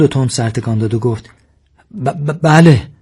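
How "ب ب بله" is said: stuck on the first sound, higher and louder; nervous stutter. A narrator's reading. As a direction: stammer out a jumpy yes. Frightened Sasan stutters his answer.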